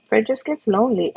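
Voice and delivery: usual speaking voice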